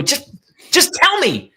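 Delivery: very high, slow, strained plea; pleading high. Retold or imitated. Mother begs crying daughter to just tell her, high strained plea. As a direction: stretch the plea way up, drawn out. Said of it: "I go".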